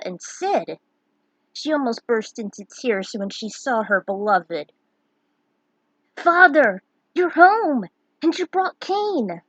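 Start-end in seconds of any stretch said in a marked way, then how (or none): 6.1-9.4 high, loud joyful cry of a son; excited exclamation. Acted. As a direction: greet father bursting with joy. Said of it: "Theodore exclaimed".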